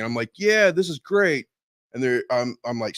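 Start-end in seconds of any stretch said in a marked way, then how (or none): 0.4-1.5 higher, drawn-out happy approval; mock enthusiasm. New recruit arriving at infantry training, naively enthusiastic. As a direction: play the naively thrilled recruit.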